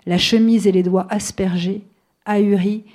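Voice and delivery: steady, firm reading of a novel passage; author's public reading voice; literary reading. A narrator's reading. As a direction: read the story out to the audience. Author reads her novel aloud.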